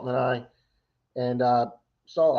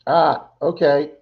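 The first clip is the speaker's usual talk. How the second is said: ordinary delivery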